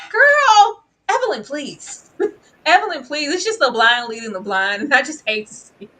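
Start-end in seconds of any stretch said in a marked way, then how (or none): 0.1-3.3 loud, high plea; exasperated call. Real, not acted. Recapper pleading with Evelyn, loud and high. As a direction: call her name in disbelief.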